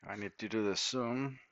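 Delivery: ordinary delivery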